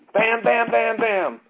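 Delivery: gavel bangs. Retold or imitated. Judge rapping his gavel.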